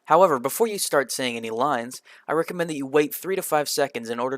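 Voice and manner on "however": ordinary delivery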